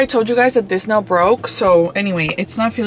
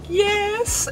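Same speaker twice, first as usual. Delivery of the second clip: high excited squeak; squealed yes; 12 semitones higher, 5 dB quieter than usual. Retold or imitated. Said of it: "And I was like".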